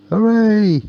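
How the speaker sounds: loud, high, delighted shout; excited cheer. Acted. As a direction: cheer for the ghosts flying over their heads. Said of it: "shouted little girl and boy".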